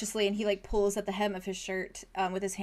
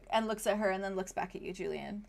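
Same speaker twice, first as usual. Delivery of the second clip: normal talking voice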